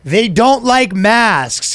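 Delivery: high, slow, drawn-out mock outrage; exasperated parent. Parent answering child about masks, mock outrage. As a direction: hammer it at the kid, hammed-up.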